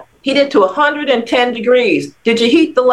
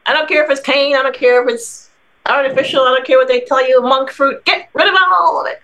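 insistent; 6 semitones higher than usual. Real, not acted. Doctor rails against sugar.

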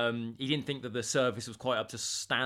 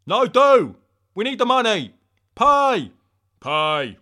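high, loud mock-desperate demand; hammed-up yell for the money; bad-cop shouting; 7 semitones higher, 10 dB louder than usual. Demanding the guest pay, high bad-cop shout.

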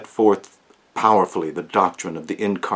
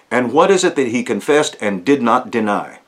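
ordinary talk

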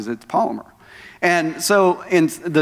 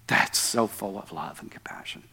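whispered, much softer; awed whisper; 7 semitones lower, 11 dB quieter than usual. Real, not acted. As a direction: breathe it out in awe. Awed remark on forgiving love.

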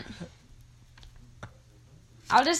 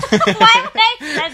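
laughing; 6 semitones higher, 9 dB louder than usual. Real, not acted. Laughs at own letter.

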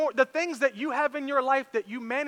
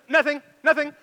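retold panicky denial; 6 semitones higher, 7 dB louder than usual. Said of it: "in a complete panic".

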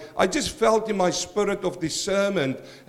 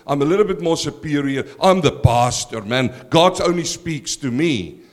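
a lower, louder, pompous put-on voice of a self-important pastor, building on "I am"; preacher mocking a proud church elder, hammed-up superior voice; mock arrogant pastor; 5 semitones lower, 5 dB louder than usual. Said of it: "They like you to walk in and go".

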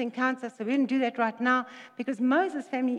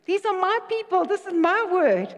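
higher and louder, proclaiming; God's bold words; 7 semitones higher, 5 dB louder than usual. Retold or imitated. Preacher voices God reassuring a frightened believer, loud and bold. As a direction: declare it like God's own promise. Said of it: "He says".

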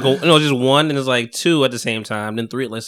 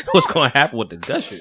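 laughing; 7 semitones higher than usual. Real, not acted. Laughing shout about gushers.

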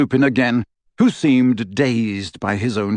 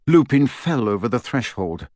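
ordinary delivery

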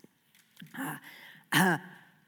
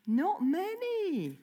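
slow mock dismay; 8 semitones higher, 3 dB quieter, much slower than usual. Real, not acted. Counting hands, mock dismay.

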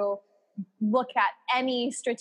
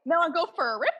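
higher, playful Canadian lilt; Canadian accent; 7 semitones higher, faster than usual. Pretend. Friend joining the slang game, high Canadian accent. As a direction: toss it out in cartoonish Canadian. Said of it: "That was real good".

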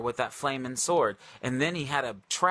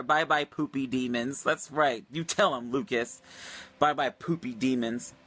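ordinary talk

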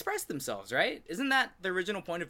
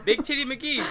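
emphatic repeat; 12 semitones higher, 7 dB louder than usual. Shown as a demonstration. Repeats name louder, higher.

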